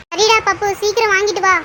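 usual speaking voice

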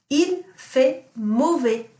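Il fait mauvais repeated slowly; teacher repeating Il fait mauvais for pupils; slow model sentence. Shown as a demonstration. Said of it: "what does it mean if I say".

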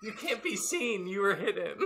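high, laughing protest. Real, not acted. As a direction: giggle through the words. Laughing correction to player.

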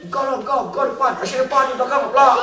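high-pitched squabble over who goes first; bickering participants. Acted. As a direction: shove the turn onto each other.

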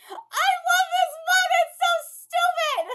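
high, loud squeal through laughter; drawn-out, high, tickled outburst; delighted laughing shout. Real, not acted. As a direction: cry it out, helpless with laughter. Delighted reaction between bursts of laughter.

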